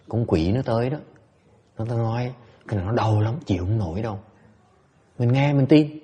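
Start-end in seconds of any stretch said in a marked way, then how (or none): none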